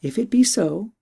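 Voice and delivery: a hint of an eager young princess. Princess voiced in story.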